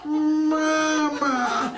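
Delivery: high, mechanical toy-doll "Mama"; sing-song talking-doll "Mama", high and flat; doll voice box. Retold or imitated. Broken baby doll voice box calls out, high and mechanical. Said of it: "voice box inside baby Jesus came back".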